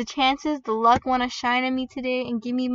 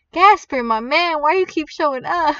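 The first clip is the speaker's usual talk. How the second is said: loud, higher-pitched complaint; exasperated raised voice; 4 semitones higher, 6 dB louder than usual. Real, not acted. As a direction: call out in exasperation at an unwanted guest. Unwanted Gasper keeps turning up.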